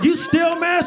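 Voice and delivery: very high shouted question; loud question. Retold or imitated. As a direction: yell it across the room. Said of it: "I'm like".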